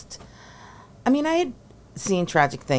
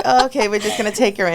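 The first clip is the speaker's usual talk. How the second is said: higher, louder, fast and breezy; mock decisive; 8 semitones higher, 7 dB louder, faster than usual. Coach imitating herself taking the girl in. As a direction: wave her in, decided. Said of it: "I'm like".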